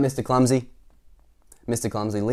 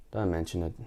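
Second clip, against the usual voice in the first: low grumbling mutter, softer; 3 semitones lower, 6 dB quieter than usual. A narrator's reading. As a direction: mutter it under your breath. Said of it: "muttered the farmer".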